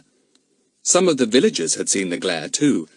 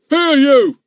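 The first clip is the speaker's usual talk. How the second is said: loud, high policeman's yell; 9 dB louder than usual. A narrator's reading. Policeman yelling at the boy with the pail, loud. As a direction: snap at the boy to get away. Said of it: "he yelled".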